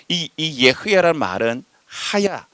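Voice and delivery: ordinary talk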